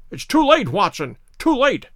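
urgent cry, pitched high at the start then dropping. A narrator's reading. Holmes to Watson as the cart rattles in, crying out. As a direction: cry out in alarm. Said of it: "cried Holmes".

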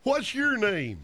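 slow, hammed-up cowboy drawl; exaggerated, drawn-out cowpoke; bad cowboy. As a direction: stretch every word like a corny movie cowboy. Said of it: "I'll do a bad cowboy".

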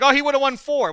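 high, loud, insistent quote; mimicking fans' claim. Retelling what people say about that game, mock-excited. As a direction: pitch it way up like an overexcited fan. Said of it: "People always look at that game like".

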